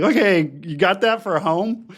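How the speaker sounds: chirpy therapist voice. Retold or imitated. Therapist voiced in a retold exchange.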